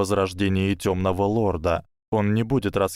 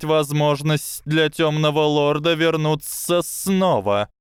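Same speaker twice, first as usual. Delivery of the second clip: high, slow serpent voice; a hissing snake, slow and sly; 8 semitones higher, 3 dB louder, slower than usual. Acted.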